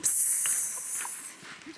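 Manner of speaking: drawn-out s like a snake; snake hiss. Shown as a demonstration. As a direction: hiss out a long s. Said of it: "that was my impersonation".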